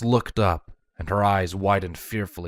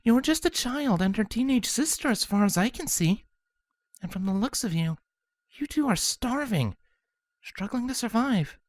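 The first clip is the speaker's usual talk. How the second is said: higher, soft gentle voice; kind princess; 13 semitones higher, 4 dB quieter than usual. Acted. Cadence gently asks the changelings their names, soft and kind. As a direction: speak softly to calm scared kids.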